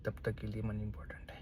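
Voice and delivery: fast, hushed whisper. Real, not acted. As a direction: drop to a quick whisper as if confiding. Confiding aside about money. (फुसफुसाते हुए, तेज़ी से)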